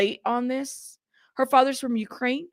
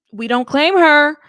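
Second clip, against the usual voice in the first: louder, higher declaration; emphatic disowning; 4 semitones higher, 9 dB louder than usual. Acted. Host loudly echoing a viewer who disowns her, emphatic. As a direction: proclaim it loudly on the group's behalf.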